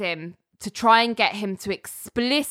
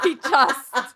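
ordinary talk

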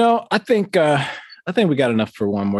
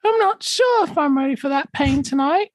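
mock old-movie housewife. Parodying the coffee ad wife to Mister McGregor, high mock falsetto. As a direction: squeak it like a melodramatic forties wife.

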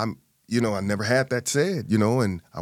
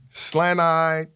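usual speaking voice

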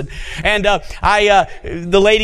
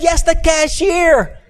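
drawn-out joyful yes from the woman; excited woman; 9 semitones higher, much slower than usual. Retold or imitated. Monica confirms, voiced.

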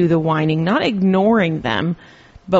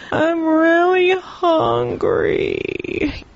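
high, slow whine dropping at the end; mock a whining child asking for food; whiny kid voice; 11 semitones higher, much slower than usual. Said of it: "Stop whining".

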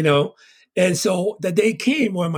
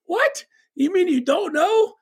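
much higher, startled disbelief; shocked kid; 12 semitones higher, slower than usual. Retold or imitated. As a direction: jump way up in pitch, caught off guard. Retold childhood talk with mom.

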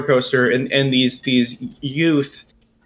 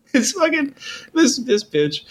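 normal talking voice

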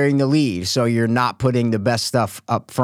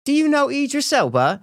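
high-pitched imitation of a British accent; 13 semitones higher than usual. Retelling British cousins' question to a friend, British accent. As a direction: put on a higher British voice for the question. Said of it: "talking to my British cousins".

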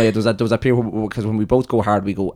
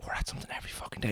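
whispered quote; 15 dB quieter, faster than usual. Retold or imitated. Quoting themselves, whispered.

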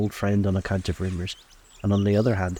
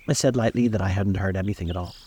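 ordinary delivery, nothing marked

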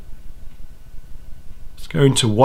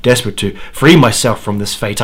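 ordinary delivery

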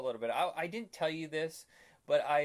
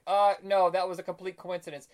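mock denial; 4 semitones higher, 8 dB louder than usual. Batman's denial, retold skit.

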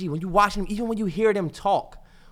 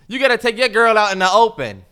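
ordinary talk

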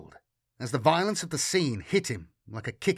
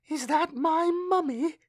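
put-on very high child's question, rising at the end; little girl. A narrator's reading. Girl asks Matthias about her mother, high child voice. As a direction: ask like a small child. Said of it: "the little girl's voice".

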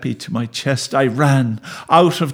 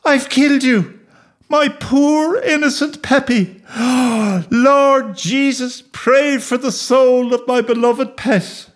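distraught wailing; 12 semitones higher than usual. A narrator's reading. Boy praying over his dead dog, distraught high wailing. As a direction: wail to heaven, high and pleading. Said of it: "Distraught, I knelt beside".

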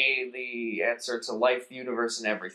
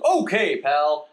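high, loud cheery computer; eager chipper machine; chirpy computer; 5 semitones higher, 7 dB louder, slower than usual. Acted. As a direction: computer answering Zaphod. Computer voice acted.

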